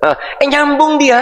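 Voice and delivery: high, slow amazed exclamation; surprised. Real, not acted. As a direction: marvel that he understood. Surprised the boy understands. (kaget dan takjub, nada tinggi pelan)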